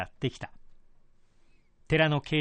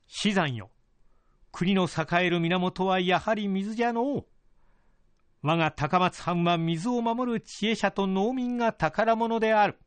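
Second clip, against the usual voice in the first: higher, measured lordly voice; feudal lord voice; 5 semitones higher than usual. Acted. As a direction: speak as a pleased old lord. Lord addresses his retainer.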